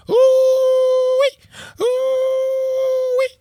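piercing high "ooh-wee" scream; women screaming. A narrator's reading. Women screaming as they storm the compound, piercing wail. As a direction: shriek a long alarm cry. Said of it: "The women continued screaming".

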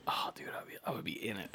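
hushed, breathy whisper. Real, not acted. Whispering to a friend about bacon sounds. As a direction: whisper it like a secret craving.

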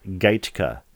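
ordinary talk, nothing marked

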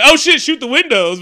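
high, loud imitation of panic; mock alarmed shout. Soldier warning the others of attackers, mock panicked shout. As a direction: yell it out like a hammed-up warning.

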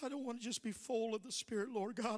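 soft, hushed prayer to Jesus; quiet prayer. Acted.

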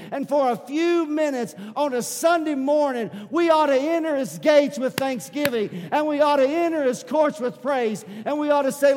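loud, high, preaching at full force. Acted. Pastor urging the church to praise, shouting in worship. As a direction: preach it out to the church with rising fire.

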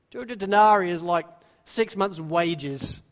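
normal talking voice

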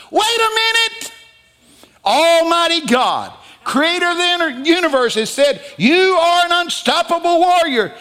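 high, loud preaching shout; shouted proclamation. Acted. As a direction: cry it out in astonished protest. Preacher shouts God's declaration in protest.

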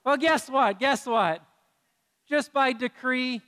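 teasing call. Real, not acted. Teasing the congregation.

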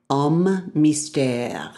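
slow, drawn-out French brand name; breathy, whispered French flourish; French accent. Pretend. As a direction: linger on it in mock-French.

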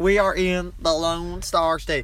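slow, loud Texan proclamation; big mock Texan drawl; hammed-up Texan accent. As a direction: holler it in a drawn-out Texan accent. Echoes friend's Texan bit, bigger.

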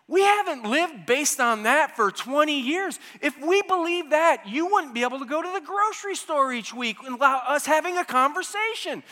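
exasperated husband arguing with his wife. Retold or imitated. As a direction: argue back in disbelief, full force. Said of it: "I was like".

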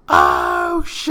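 loud, high drawn-out holler; retold hyped reaction. Narrator reacts to the guy backing down, hyped holler. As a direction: whoop it out long and loud.

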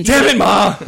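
high loud whining yell at mom; annoyed son yell. Pretend. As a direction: yell back like a frustrated kid.

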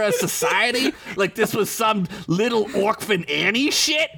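high, sarcastic crime boss; mock him with a squeaky sneer; sneering Crinkles. Crinkles lays into Joey.

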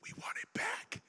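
Disney taunting Netflix, sinking into a whisper; sly Disney voice. Retold or imitated. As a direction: hush it like a smug secret. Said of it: "You're like".

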